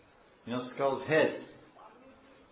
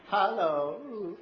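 playful hello; 6 semitones higher than usual. Retold or imitated. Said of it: "I go".

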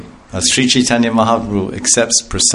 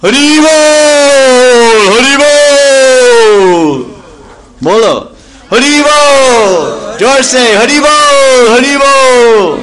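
high, long, drawn-out shouted Hari bol; very loud; 7 dB louder, much slower than usual. Acted. Mahaprabhu rousing the assembled people, very loud drawn-out shout. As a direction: shout it to the whole crowd, stretching every bol. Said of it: "said very loudly".

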